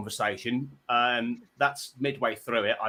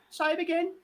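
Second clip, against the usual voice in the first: Brummie; 3 dB quieter than usual. Retold or imitated. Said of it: "He sounded like he was a Brummie".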